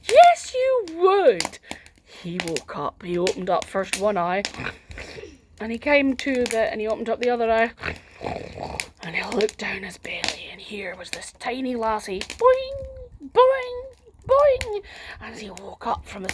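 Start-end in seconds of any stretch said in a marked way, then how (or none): 0.0-1.6 emphatic yes. Real, not acted. Storyteller playfully overruling the child's answer, high and loud. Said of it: "answers child's no emphatically". 12.2-14.8 squeaky high bouncing boings. A narrator's reading. Narrator voicing the girl bouncing on the belly, squeaky high. Bouncing sound effect in story.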